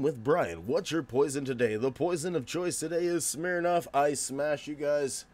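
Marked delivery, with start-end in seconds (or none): none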